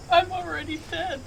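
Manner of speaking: high, tearful little voice; soft sobbing voice. Acted. Hidden sobbing woman answering an armed threat, tearful. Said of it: "You hear a soft voice go".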